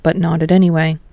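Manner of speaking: ordinary delivery, nothing marked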